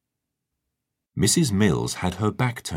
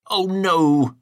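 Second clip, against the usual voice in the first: alarmed; 12 semitones higher, 6 dB louder than usual. A narrator's reading. Tiger caught in the mug.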